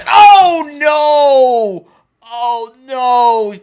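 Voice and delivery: loud, high cry of alarm; startled shout of oh no. Real, not acted. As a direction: yelp as the cat goes in. Cat about to fall in water.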